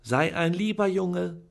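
high, sugary coaxing; sweetly fluting. A narrator's reading. Aunt sweet-talking Charlie into looking again. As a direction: sweeten it to lure him in. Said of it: "flötete Tante Venetia".

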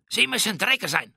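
high-pitched, indignant captain's voice; indignant Spanish captain. A narrator's reading. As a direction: address your captor in outrage. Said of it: "blickte er Drake wutentbrannt an".

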